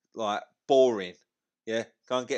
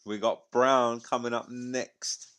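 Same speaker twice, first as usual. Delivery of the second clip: ordinary talk, nothing marked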